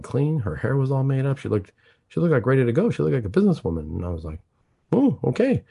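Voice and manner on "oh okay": high, startled oh okay; surprised. Retold or imitated. Doctor startled by the patient's new look, high surprised oh. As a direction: caught off guard by how she looks.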